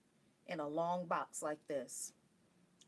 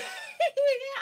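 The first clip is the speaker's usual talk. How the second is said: loud, high-pitched laugh; high giggle; 9 dB louder than usual. Real, not acted. Laughing that they missed the plane, high giggle. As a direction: burst into giggles.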